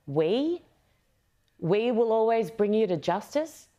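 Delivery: questioning, voice rising at the end; incredulous echo. Retold or imitated. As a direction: throw the quote back in disbelief. Incredulous echo of Harris's line.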